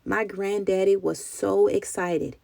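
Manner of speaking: usual speaking voice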